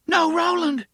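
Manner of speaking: loud horrified scream; horrified cry. Acted. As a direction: shriek it in horror as he throws. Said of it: "the sound of her own horrified voice".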